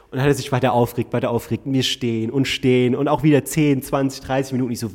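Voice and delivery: ordinary talk, nothing marked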